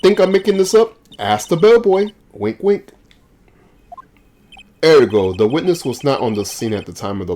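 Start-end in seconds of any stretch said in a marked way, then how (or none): 0.0-2.9 higher, louder teasing challenge; smug taunt. Acted. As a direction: dare him to check. Witness taunts the lawyer.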